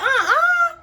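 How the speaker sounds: high, loud, tearful no; panicked cry. Retold or imitated. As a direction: refuse in terror. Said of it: "I'm like".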